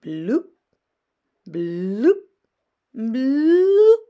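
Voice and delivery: bloops soaring to a high loud finish; rising bloops. A narrator's reading. Reader hamming up the fish's bloops. Refrain of fish sounds.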